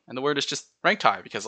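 ordinary talk, nothing marked

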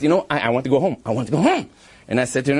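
usual speaking voice

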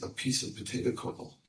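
soft, breathy whisper; hushed. Real, not acted. Preacher whispering the punchline to the ladies.

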